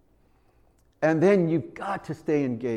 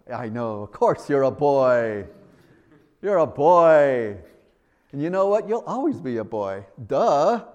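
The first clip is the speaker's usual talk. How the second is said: louder, hammed-up dad gushing to his son; silly dad voice; 7 dB louder than usual. Dad teasing his son about being a boy, silly and loud. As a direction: overdo the proud father, grinning. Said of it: "Why are you talking like this".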